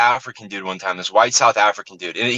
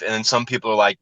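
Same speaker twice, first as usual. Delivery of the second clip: ordinary delivery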